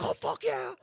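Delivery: very high, strained cry of triumph; reenacted victory cheer. Fighter learns he won his debut, high-pitched reenacted cheer. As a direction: squeal it as a mock celebration.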